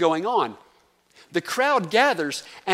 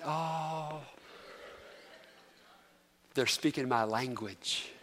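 soft, low, melting sigh of recognition; heartfelt relief; 3 semitones lower, 12 dB quieter, slower than usual. Retold or imitated. Preacher recalling hearing his own dialect, softly touched. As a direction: let the heart melt, very quietly. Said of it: "you know what my heart did?".

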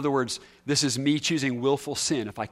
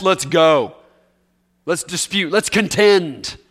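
louder, spoiling for a fight; mock challenge; 2 semitones higher, 7 dB louder, slower than usual. Preacher acting out a disputer picking a fight, loud mock challenge. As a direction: call out a fight.

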